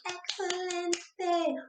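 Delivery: drawn-out praise. Pretend.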